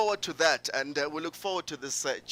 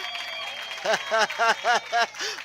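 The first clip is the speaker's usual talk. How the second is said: long, high burst of laughter; real, sustained high chuckling; hearty laugh; 8 semitones higher than usual. Real, not acted. Host laughs after rallying audience.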